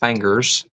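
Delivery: fangers said FANG-ers, for fingers; Appalachian pronunciation. Shown as a demonstration. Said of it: "the ever famous".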